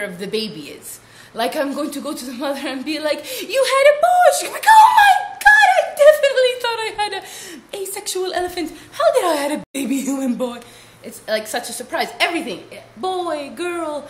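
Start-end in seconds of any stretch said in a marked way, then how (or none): 3.4-4.3 high, loud mock announcer; high, loud hammed-up reveal; mock announcement. Mock announcing to new mother. 4.7-10.6 hammed-up shocked mother, laughing; mock shock.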